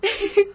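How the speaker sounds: risinho curto e agudo. Acted. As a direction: ri sem graça da provocação. Ri da provocação da amiga. (Lúcia ri, encabulada, da provocação de Ana)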